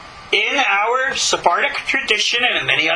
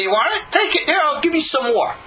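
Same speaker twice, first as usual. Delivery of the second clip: higher, quick-fire taunting giveaway; acted-out defiant generosity; defiant mock offer; 8 semitones higher, faster than usual. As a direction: dare them to take it, pitched up. Acted defiant reply to robbers.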